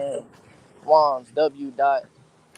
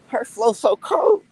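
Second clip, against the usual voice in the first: excited; 12 semitones higher, 5 dB louder, slower than usual. Real, not acted. Hyped about [name]'s flow.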